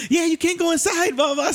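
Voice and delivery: high-pitched mimicry of a chaperone; high, mocking retold warning; imitated chaperone. Voices chaperone shouting from van.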